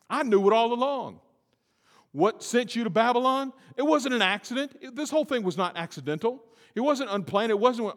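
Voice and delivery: high, loud preaching; preaching at full force. Real, not acted. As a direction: drive it home from the pulpit.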